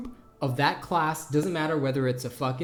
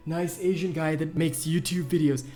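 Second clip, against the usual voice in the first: mocking rival impression. Mimicking whining rival guild member.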